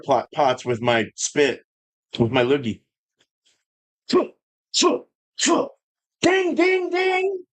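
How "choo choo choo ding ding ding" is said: high sing-song choo choo and ding ding; playful train noises. Pretend. Goofing around with high train and bell noises. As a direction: toot like a toy train, pitched way up.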